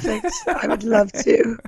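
laughing mock British accent, high on perfect; half-laughing posh British put-on. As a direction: giggly fake British accent. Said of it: "do the rest of the podcast like this".

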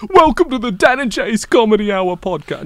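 put-on loud English accent host intro; English accent, announcing the show; John Oliver, English accent. English accent, John Oliver hosting their podcast intro. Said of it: "him keeping the English accent".

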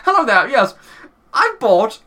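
high, loud, drawn-out mock complaint call; pissed-off customer. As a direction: act out an indignant phone complaint, pitched high. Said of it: "I rang Asda and everything".

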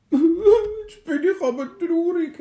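high, loud sobbing wail; sad robot crying. Acted. Robot wails to the dwarf, high and sobbing. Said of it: "hat angefangen zu brüllen".

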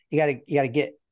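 ordinary talk

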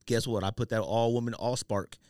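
ordinary delivery

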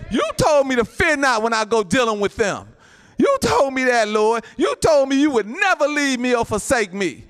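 fervent pulpit cry; insistent prayer. Acted. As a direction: hold God to the promise, pushing hard. Acts believer reminding God of promises.

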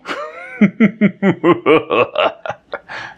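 high, loud mocking cackle; mean laugh. Shown as a demonstration. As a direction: cackle cruelly at someone thirsty. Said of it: "do you know how I would laugh?".

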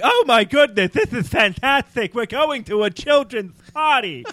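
high, slow, giddy happy clown; bubbly children's-party clown voice; Ed Wynn voice. Retold or imitated. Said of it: "have to be Ed Wynn".

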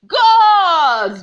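huge high roar of rage; bellow. Acted. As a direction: bellow it out with full force. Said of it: "bellowed the Balogun".

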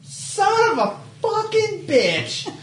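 high, slow laughing curse; frustrated yell. Real, not acted. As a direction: wail at the bad roll. Bad roll outburst.

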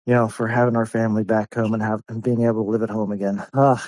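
ordinary talk, nothing marked

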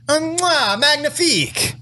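high chef's kiss, then Magnifique; french chef. Pretend. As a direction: play a proud French chef. Praising hazelnut syrup like chef.

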